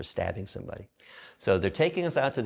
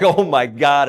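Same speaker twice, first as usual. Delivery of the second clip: startled; 5 semitones higher, 13 dB louder, slower than usual. Retold or imitated. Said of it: "I was like".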